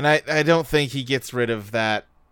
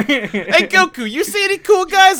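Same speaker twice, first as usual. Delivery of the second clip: much higher, louder teasing question; high mocking voice; 14 semitones higher, 5 dB louder than usual. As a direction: squeak it out mockingly. Mock quote of Bulma.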